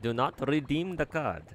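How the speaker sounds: a hammed-up Indian accent. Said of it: "my Indian accent is very terrible".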